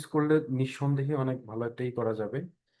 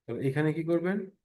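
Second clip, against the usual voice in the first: ordinary talk, nothing marked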